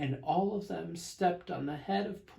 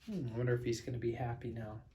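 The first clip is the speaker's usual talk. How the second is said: soft aside; 3 semitones lower, 8 dB quieter, much faster than usual. Real, not acted. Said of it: "aside to the young listeners".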